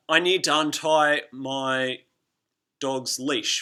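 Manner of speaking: "I need to untie" said slow and casual, "to untie" slurred together; slow casual example line; everyday example sentence. Shown as a demonstration. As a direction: say "need to untie" as in ordinary talk. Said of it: "for example".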